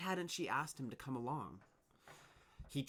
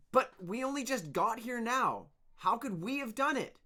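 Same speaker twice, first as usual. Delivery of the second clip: higher, louder call from the crowd; 12 semitones higher, 9 dB louder than usual. Acted. Worker calling out in protest to the leader, loud. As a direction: shout the protest across. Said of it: "someone called out".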